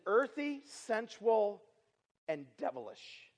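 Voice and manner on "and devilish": ominous low. Acted. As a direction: drop to a deep, hushed tone on devilish. Preacher drops voice on devilish.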